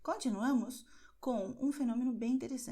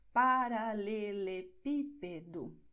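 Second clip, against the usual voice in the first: "Paralelepípedo" slowly, syllable by syllable, PI stressed; 3 dB louder, much slower than usual. Shown as a demonstration. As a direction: stretch out "Paralelepípedo" with the weight on PI. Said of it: "nessa sílaba pi, e é a sílaba que vai ser destacada". ("Paralelepípedo" devagar, destacando o PI)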